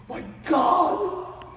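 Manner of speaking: high, loud, strained disbelief; hammed-up high-pitched shout; incredulous shouting. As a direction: cry it out slowly, aghast and amazed. Uncle's awed relief acted out.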